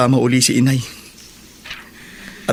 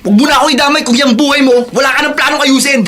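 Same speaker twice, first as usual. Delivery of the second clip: raised, heated voices in a jail scuffle; angry quarrel. Acted. Inmate shouting at the bully who took his things, angry. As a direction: push back hard at the bully. Prison bullies quarrel over belongings.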